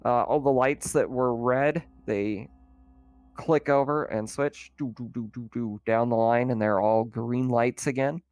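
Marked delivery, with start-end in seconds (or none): none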